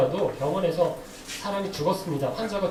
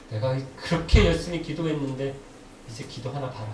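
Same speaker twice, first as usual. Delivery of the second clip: mock sulking in a lowered voice; sulky grumble; 6 semitones lower than usual. Preacher acting a believer let down after praying hard, mock sulking. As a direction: imitate a huffy believer, dropping low at each threat. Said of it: "이렇게 삐져요".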